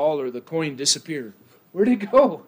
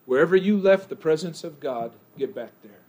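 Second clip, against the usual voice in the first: normal talking voice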